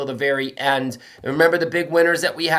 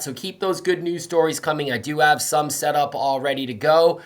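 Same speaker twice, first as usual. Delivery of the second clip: normal talking voice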